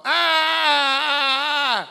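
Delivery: loud high pained scream with tongue stuck. Retold or imitated. Preacher acting out his brother's tongue-stuck scream, loud and high. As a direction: scream like a kid with his tongue frozen to a can.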